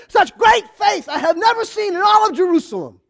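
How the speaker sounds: high, loud proclamation of great faith; Jesus marvelling at full voice; booming praise. Acted.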